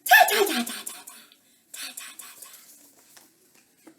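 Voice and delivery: staccato chitter fading to voiceless; rapid little monkey noises, trailing into whisper; monkey chatter. A narrator's reading. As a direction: imitate monkeys, dying away to almost nothing. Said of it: "back at him and went".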